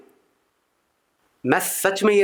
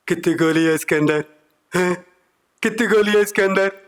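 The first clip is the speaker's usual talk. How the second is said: menacing filmi bandit, rising on the repeat; Gabbar Singh imitation. Gabbar questions his men, hammed-up bandit menace. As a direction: growl out the famous bullets line. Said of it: "Gabbar Singh बैठे हुए थे".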